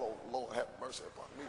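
praying under his breath for mercy; hushed mock prayer; whispered prayer. Boy's silent prayer before beating.

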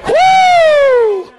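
loud, high, sustained "woo"; exultant whoop. Real, not acted. Pastor carried away by his own sermon, exultant whoop. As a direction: let out a big preacher's whoop.

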